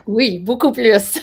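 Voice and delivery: giggling through the French answer; laughing reply. Real, not acted. Host praises her French, she answers laughing.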